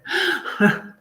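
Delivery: sudden high giggle; high embarrassed laugh. Real, not acted. Admitting she avoids her brother, high awkward laugh. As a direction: laugh off the honest confession.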